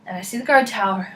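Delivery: normal talking voice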